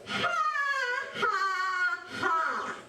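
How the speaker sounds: high teasing ha ha ha; mock laugh. Eric mocking the fooled alligator with a high laugh.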